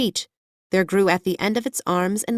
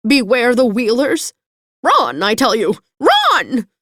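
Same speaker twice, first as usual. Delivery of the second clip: loud, higher frantic urging; panicked shouting; 5 semitones higher, 7 dB louder than usual. A narrator's reading. Hen urges Dorothy to flee, loud panicked shouting. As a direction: yell at her to run.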